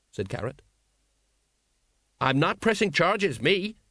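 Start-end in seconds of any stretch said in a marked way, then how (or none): 2.2-3.8 higher, hasty disclaimer; hurried. A narrator's reading. Sergeant backing out of a complaint, hurriedly. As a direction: get out of it quickly. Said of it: "said the sergeant hurriedly".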